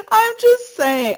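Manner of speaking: high, loud words through laughter; cracking up. Real, not acted. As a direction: squeeze it out while laughing hard. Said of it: "not that it should crack me up".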